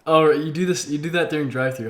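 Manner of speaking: ordinary talk, nothing marked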